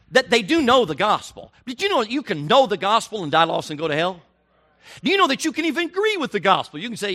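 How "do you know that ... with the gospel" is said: much higher and louder, pressing the question; fervent preaching climax. Real, not acted. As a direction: rise up and hammer it at the crowd. Preacher rising to a peak.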